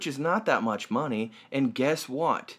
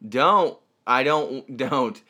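usual speaking voice

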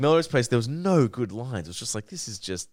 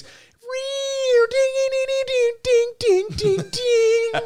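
high sung dings; 5 dB louder, much slower than usual. Retold or imitated. Imitating character's delighted reaction.

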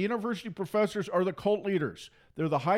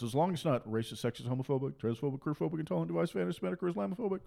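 softer list sped up at the end; rattled-off list; 3 semitones lower, 5 dB quieter, faster than usual. Retold or imitated. Listing what censors forbid, soft rushed rattle. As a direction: tick off the labels dismissively, racing.

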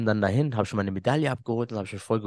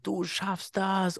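imitating tearful sister; 7 semitones higher, 6 dB quieter, much slower than usual. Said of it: "sie in Tränen aufgelöst".

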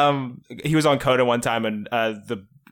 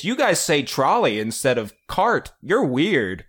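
mocking quote; 5 semitones higher, 5 dB louder, slower than usual. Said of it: "half the conversation was".

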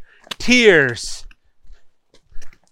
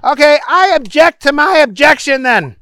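high, loud retraction; objecting Booger; 11 semitones higher, 6 dB louder than usual. A narrator's reading. Booger reversing himself before the judge, high and loud. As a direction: declare it forcefully at high pitch.

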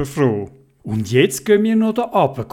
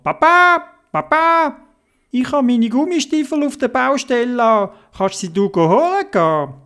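put-on child calling Papa; 9 semitones higher than usual. Theobald runs to Papa, a high child's call. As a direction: call to Papa like a little boy.

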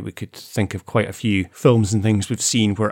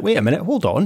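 mock pig man; 7 semitones higher, faster than usual. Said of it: "you would think the pig men would be like".

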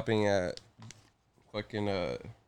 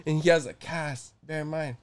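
normal talking voice

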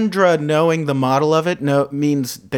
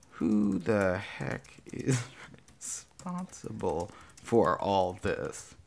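slow, quiet, low self-dictation; soft, drawn-out drafting voice; 4 semitones lower, 10 dB quieter, slower than usual. Real, not acted. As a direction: say it as you type it. Typing out the agreed line.

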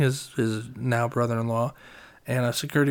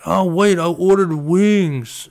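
retold drunk friend; 5 semitones higher, 6 dB louder, much slower than usual.

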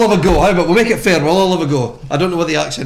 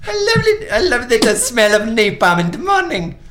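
higher-pitched, badly faked Mexican accent; 7 semitones higher than usual. Player attempting a film quote for the accent-guessing game, shaky Mexican accent. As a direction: have a hammy go at a Mexican accent.

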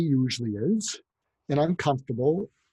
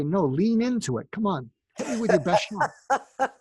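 higher, laughing push to lean into it; egging him on; 6 semitones higher than usual. Retold or imitated. Mother egging her teenage son to vent, higher and laughing. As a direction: coax him to let it out, half laughing. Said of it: "And then you say".

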